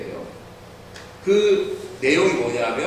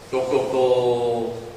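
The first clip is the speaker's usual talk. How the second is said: slow knocking sound; 6 semitones lower, much slower than usual. Retold or imitated. Imitating knocking.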